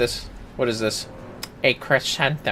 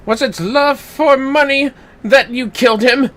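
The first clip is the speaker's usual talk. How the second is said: higher, louder detective accusation; dramatic accusation; 8 dB louder than usual. Acted. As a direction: unmask the killer theatrically. Accusing in character.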